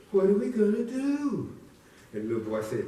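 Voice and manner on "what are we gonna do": worried mama. Acted. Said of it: "she looked".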